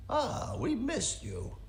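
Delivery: put-on high, slow, wobbly old grandpa. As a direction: drag it out like a frail old grandpa. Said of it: "That's my grandpa voice".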